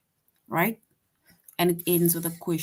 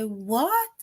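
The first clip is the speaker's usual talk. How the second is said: high confused "What"; baffled "What"; 5 semitones higher than usual. Pretend. Teacher miming a confused reply to a random statement. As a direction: react as if it made no sense. Mock awkward replies.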